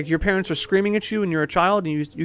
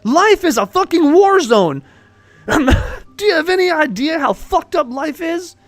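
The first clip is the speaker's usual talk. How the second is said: high, forceful tirade; worked-up, raised-voice ranting; heated rant; 8 semitones higher, 4 dB louder than usual. Real, not acted. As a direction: hammer the listener with hard truths. Heated rant on how harsh life is.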